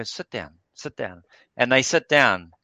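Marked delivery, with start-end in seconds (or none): 0.0-1.2 put-on soothing old man. Old man calming the grandson.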